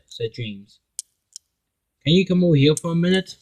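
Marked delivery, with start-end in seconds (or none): 2.0-3.4 calling over. Acted. James calls Philip over.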